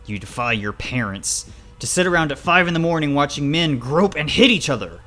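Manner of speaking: outraged scolding. Acted. Father berating child for watching wrestling, outraged.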